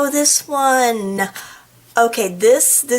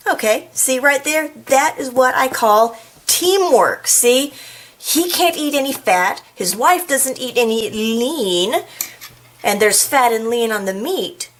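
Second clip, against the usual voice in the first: ordinary delivery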